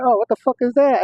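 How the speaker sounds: high, startled outburst; shocked. Retold or imitated.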